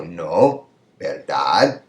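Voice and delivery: "No" and "verdad" each lifted like questions; rising tags. Shown as a demonstration. Teacher repeating the question tags for students, rising tune. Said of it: "an up pitch".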